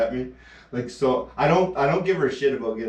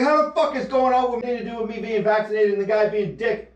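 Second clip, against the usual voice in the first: much higher, exasperated rant; mock indignant protest; 9 semitones higher than usual. Emma asking what the rude guy has to do with it, mock outrage. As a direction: demand an explanation in disbelief.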